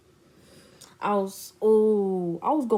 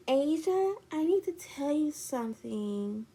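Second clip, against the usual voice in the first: higher, put-on hesitant friend; imitated confessing friend; 6 semitones higher, 4 dB quieter than usual. Friend has news to tell her, mock hesitant. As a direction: mimic a friend leading into a confession.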